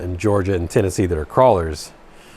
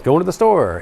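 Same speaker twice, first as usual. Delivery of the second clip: higher Georgia accent, "to" softened without its T; Georgia accent, dropped T's; 7 semitones higher than usual. Shown as a demonstration. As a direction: say "Going to the store" Southern, swallowing the T. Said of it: "People don't say T's in Georgia".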